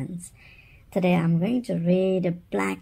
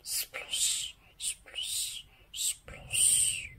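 very soft breathy splosh; barely audible splosh; whispered splosh; 15 dB quieter, much slower than usual. Acted. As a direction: whisper the splashes. Whispered splash sound.

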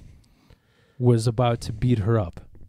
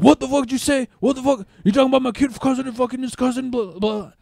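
much higher put-on voice, fast and furious; enraged imitation; 11 semitones higher than usual. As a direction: squeal it out in a furious rush.

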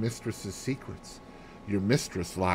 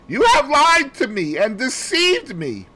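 high, loud, sharp accusation; sharp and clear; 7 dB louder than usual. Retold or imitated. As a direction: cut him with every word. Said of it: "speaking very sharp and clear".

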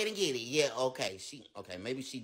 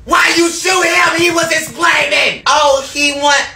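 loud frantic yelling throughout; screaming frantic argument over a gun; panicked shouting; 4 semitones higher, 15 dB louder than usual. Acted. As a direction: yell in a panic over the bite. Panicked zombie-bite scene, shouting.

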